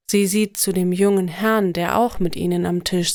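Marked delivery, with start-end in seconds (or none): none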